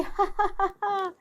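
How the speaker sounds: high wicked villain cackle; evil cackle. Acted. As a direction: cackle over your scheme. Villain laughs after plotting.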